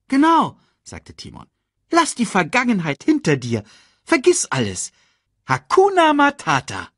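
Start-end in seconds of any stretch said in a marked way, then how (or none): 0.1-0.6 high, loud meerkat interjection; agreeing meerkat. Acted. Meerkat backing up warthog, high emphatic agreement. 1.9-6.9 high meerkat pep talk; upbeat meerkat. Acted. Meerkat cheering up the cub, high emphatic voice.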